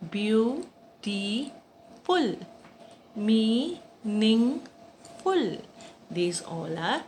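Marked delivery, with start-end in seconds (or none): 0.1-4.6 "Beautiful, meaningful" each in three separated beats; syllable by syllable. Shown as a demonstration. Teacher showing children three-syllable words, syllable by syllable. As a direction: break it slowly as "beau-ti-ful, mea-ning-ful". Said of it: "three syllable".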